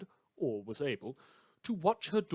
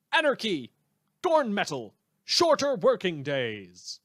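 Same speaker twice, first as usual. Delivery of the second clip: rallying cries; 5 semitones higher, 10 dB louder than usual. Acted. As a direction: yell it like a rally chant to listeners. Host's rallying sign-off cries.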